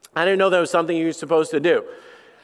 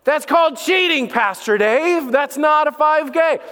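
mock scolding call-out; 6 semitones higher than usual. Pastor calling out Pastor Dave for cheating, mock scold.

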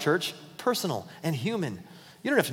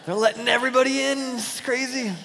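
laughing; 7 semitones higher than usual. Real, not acted. Laughing through the joke.

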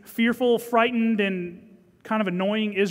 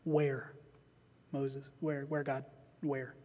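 soft, lower, wondering where; a quietly baffled Moses asking; hushed puzzled question; 5 semitones lower, 12 dB quieter, slower than usual. Acted. Preacher voicing Moses, hushed and puzzled.

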